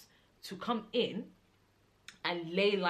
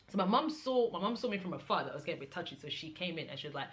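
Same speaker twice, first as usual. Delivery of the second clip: ordinary talk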